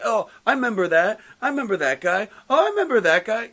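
high-pitched, gushing nostalgic squeal in play; member berries. Acted. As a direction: imitate excited recognition, rising at each end. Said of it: "[name] with the member berries".

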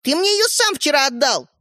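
put-on high-pitched girl arguing back; indignant retort. A narrator's reading. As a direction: throw the blame back. Sister retorts in the quarrel. (возмущённо)

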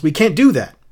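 high, loud plaintive protest; wailing. A narrator's reading. Upset guest objecting to reading poems, wailing. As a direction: wail it in dismay. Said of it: "wailed Missus Bowles".

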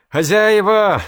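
loud call across the yard; shouting. A narrator's reading. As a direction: call out for the owners at full voice. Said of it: "закричала Мирослава".

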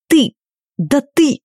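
high indignant sputter; sputtering outrage. A narrator's reading. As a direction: choke on your own outrage.

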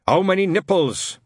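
put-on loud, barking old Scot; gruff old sergeant. Shadwell questioning Newton, gruff and loud. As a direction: bark the question.

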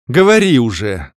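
mild exhaled snap; through gritted teeth. Acted. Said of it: "скрипнув зубами, выдохнула я". (сквозь зубы)

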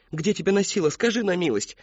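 high scolding demand; girl scolds the boy, angry and scared. A narrator's reading. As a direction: scold him with fright underneath. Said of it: "сердитая, перепуганная Мэри Кэтрин".

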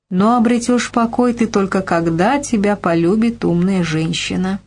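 ordinary delivery

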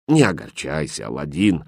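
low-pitched, gentle consolation; tender. A narrator's reading. Kindly stranger consoling Aladdin, tender. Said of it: "ласково сказал незнакомец".

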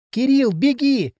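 high, drawn-out cry of warning; sudden cry. A narrator's reading. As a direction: call out urgently to make him run. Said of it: "закричала вдруг она". (внезапный высокий крик)

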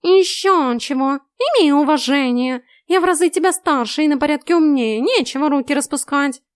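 loud, high indignant hissing cat; snooty bored cat. A narrator's reading. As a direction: huff like an offended old tomcat. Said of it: "с шипением отскочил".

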